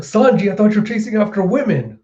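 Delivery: high-pitched astonished exclamation; retold surprised cry; mock surprise. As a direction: act out disbelief at who arrived. Surprised at rescuer's arrival.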